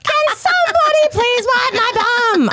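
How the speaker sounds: put-on high-pitched, stretched-out holler as a kid; screaming child. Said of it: "repeatedly hear Cuba screaming".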